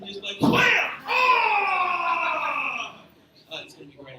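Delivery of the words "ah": loud mock sneeze yell, high; fake sneeze cry. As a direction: blast out a hammed-up sneeze. Pepper sneeze attack gag.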